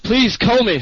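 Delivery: loud, high yell out. Retold or imitated. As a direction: call out loudly. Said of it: "a guy yelling out".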